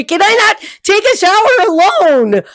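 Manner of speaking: loud, very high mock outburst; hammed-up shriek of frustration; exasperated yell. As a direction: yell it out, stretching the last word. Acted protest at clingy boyfriend.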